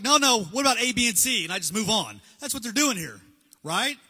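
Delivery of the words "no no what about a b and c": louder, higher, changing the subject; loud dodging insistence. Retold or imitated. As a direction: steamroll past him with a list. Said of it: "I'm like".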